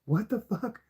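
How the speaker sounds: high, hushed put-on disbelief; frustrated quote. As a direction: mutter it high in mock frustration. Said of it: "I'm just like".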